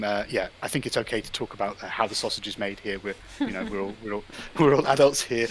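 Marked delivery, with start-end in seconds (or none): 3.4-5.5 chuckling through the words; amused, laughing. Real, not acted. Speaker excusing candid talk about technical problems, laughing. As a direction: break into a real chuckle while talking.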